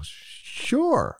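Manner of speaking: high, squeaky, drawn-out mock-nervous "sure"; nervous mock agreement. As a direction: squeak out a reluctant yes, comically unsure. Scared, agreeing to help anyway.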